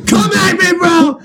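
high cocky taunt; mock bravado. Patient taunting the doctor, high and cocky. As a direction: taunt the needle like a tough guy.

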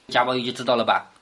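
ordinary talk

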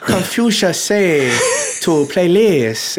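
drawn-out hammed-up Chinese sage accent; Confucius. Showing last time's bad accent, slow mock Chinese. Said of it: "he sounds like Confucius last time".

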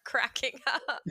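breaking into laughter mid-sentence; real laughing. Real, not acted. Host telling coach about cracking up, laughing.

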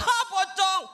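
high, mock-scared yelp; frightened cry. Someone spots a pocong in the village, frightened cry. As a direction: yelp it in mock terror, pitch jumping up. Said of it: "kan ketakutan gitu".

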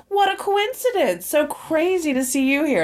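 high, mock-casual Bill. Friend mimics Bill feigning surprise at dinners, high mock voice. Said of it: "Bill's always like".

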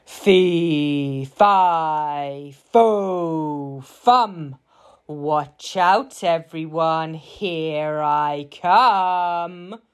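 big booming giant voice with long-held words; roaring giant. A narrator's reading. Giant announcing himself before supper, roaring and drawn out. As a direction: roar it out, stretching the last word. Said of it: "roared the giant".

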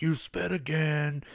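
high, slow put-on warning; mock-threaten her, drawing the words out; imitated threat. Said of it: "threatens her".

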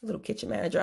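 ordinary talk

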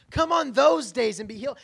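loud mocking imitation of the ruler; mocking indignant ruler. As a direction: throw the ruler's words back with scorn. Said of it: "What a punk".

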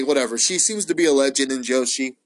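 ordinary talk

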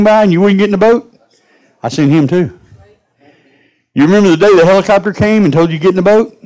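a preacher's booming mock God, laying out the proof; God scolding. God answers the angry lady.

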